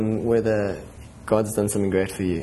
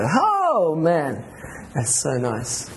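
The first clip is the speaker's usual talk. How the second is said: awed exclamation; 4 semitones higher, much slower than usual. Retold or imitated.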